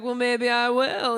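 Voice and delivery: slow, teasing maybe; slow, playful hesitation; drawn-out maybe. Retold or imitated. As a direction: stretch it out coyly, half-committing. Coy reply to the matchmaking friend.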